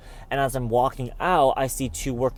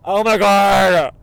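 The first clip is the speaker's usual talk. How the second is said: excited gasp; 6 semitones higher, 9 dB louder, slower than usual. Real, not acted. Finds lost SD card.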